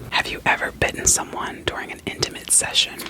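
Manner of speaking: ordinary talk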